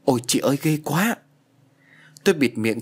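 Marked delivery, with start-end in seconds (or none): 0.0-1.2 mild, higher-pitched cry of horror; narrator's understated frightened exclamation; horrified cry. A narrator's reading. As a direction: voice a horrified yelp without full volume. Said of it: "Chị kinh hãi thét lên".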